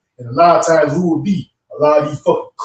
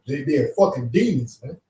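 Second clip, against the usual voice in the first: ordinary talk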